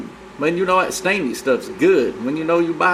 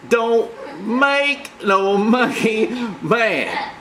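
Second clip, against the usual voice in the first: higher, very slow, laughing put-on voice; monkey voice growl; 4 semitones higher, much slower than usual. Warning the other persona in a silly monkey voice. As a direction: stretch it out like a cartoon monkey threat.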